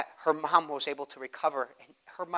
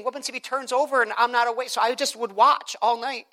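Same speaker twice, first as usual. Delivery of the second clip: higher and louder preaching; full-force preaching; 9 semitones higher, 5 dB louder than usual. Acted. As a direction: preach it up to the congregation.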